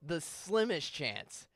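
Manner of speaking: slow, "slimmest" stretched and stressed; slow hammered-in caveat; repeated emphasis. Real, not acted. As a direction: drive home "The slimmest chance" slowly. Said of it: "pay attention to my statement here very closely".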